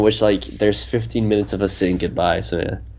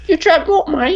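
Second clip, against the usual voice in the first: very high, squeaky British mimicry; mimicked British accent. Copying the co-host's line, high mock British accent. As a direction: parrot it back far up in pitch, British-style. Copies co-host's put-on question.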